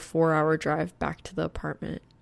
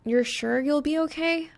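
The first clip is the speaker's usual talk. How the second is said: put-on higher, concerned young woman; worried girl voice; 9 semitones higher than usual. A narrator's reading. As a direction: pitch up with worry.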